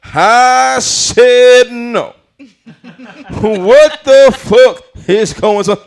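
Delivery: loud, high-pitched comedian impression; Bernie Mac. Comic rereads the quote as an adult, loud high impression. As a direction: belt it out as a brash adult comic. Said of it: "reread it as Bernie Mac's voice".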